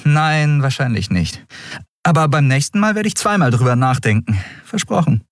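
Endriel, teasing. Acted. Captain teasing Kero after his threat, quick and light. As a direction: wink it through the promise.

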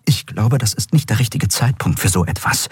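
plain reading voice

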